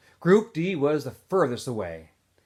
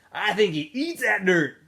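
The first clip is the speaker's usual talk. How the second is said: higher, louder put-on voice; Zigzag's voice; 8 semitones higher, 6 dB louder than usual. A narrator's reading. Zigzag teasing about Zero, high and loud. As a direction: tease it wide-eyed.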